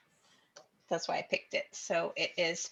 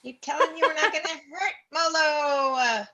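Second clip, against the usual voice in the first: loud, high, drawn-out protest; exasperated yell; 8 semitones higher, 9 dB louder than usual. Acted. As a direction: shout back slowly in exasperation. Shouting back at the kobold.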